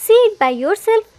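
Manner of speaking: happy and loud, proud of the surprise. A narrator's reading. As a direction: burst out gleefully to show off the guest. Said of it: "Jimin, happily".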